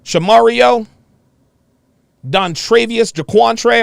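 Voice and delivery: ordinary talk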